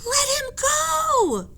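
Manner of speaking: very high, pleading cry; desperate scream. Acted. Annie screams to the men, very high and desperate. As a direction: beg through tears. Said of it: "screamed Annie".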